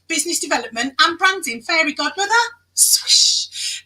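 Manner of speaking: whispered title, then a breathy swish; conspiratorial whispered swish; playful whisper. Pretend. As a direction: breathe it like a magic spell. Fairy godmother catchphrase.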